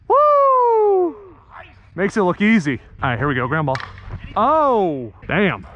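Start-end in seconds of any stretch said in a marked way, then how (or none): 0.1-1.2 long, high "woo"; excited whoop. Real, not acted. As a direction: hold a high cheering whoop. Cheering a play.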